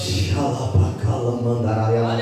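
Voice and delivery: low murmured tongues; brief glossolalia murmur; speaking in tongues. Real, not acted.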